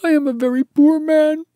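very high, loud mock-tearful wail; sobbing, acted. A narrator's reading. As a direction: bawl it like a pitiful little creature. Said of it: "he sobbed".

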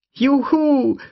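put-on very high, loud child's whoop; joyful cheer. Lex cheers as they escape, very high joyful whoop. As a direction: cheer like a kid set free. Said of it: "rief Lex".

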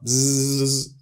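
long loud bee buzz; buzzing bee noise. Retold or imitated. Stung man explaining to Polish woman, buzzing like a bee.

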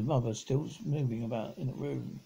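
ordinary delivery, nothing marked

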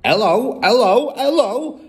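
high, loud parrot squawks; parrot calling. A narrator's reading. Hidden parrot calls to the family, squawking. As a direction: call out brightly, each one rising. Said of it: "came the voice again".

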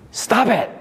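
sharp high "Stop it!"; mock comic yell; Bob Newhart snapping. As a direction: bark it at her like the psychiatrist. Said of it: "and he would say".

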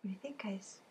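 a soft whispered question to the class. Real, not acted. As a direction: breathe it, no voice.

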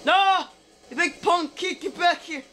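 hammed-up outraged chase call; mock-angry shouting. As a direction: pretend to bellow after someone escaping. Taunting rival during game race.

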